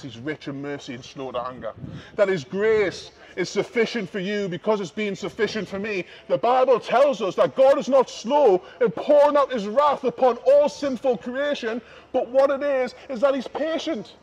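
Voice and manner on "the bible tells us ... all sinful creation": normal talking voice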